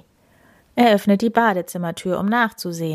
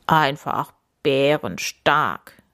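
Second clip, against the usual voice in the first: low, slow bear voice; bear rumble; 5 semitones lower, slower than usual. Acted. Max cheering Paul, low slow bear rumble. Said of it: "brummt Max".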